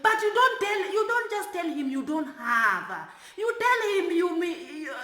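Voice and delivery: mock shout him down; imitated angry lecture; retold yelling. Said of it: "started yelling".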